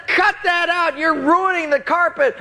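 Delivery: high-pitched mock-outraged protest; exasperated scolding. Acts out scolding the prankster.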